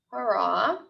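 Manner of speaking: ordinary delivery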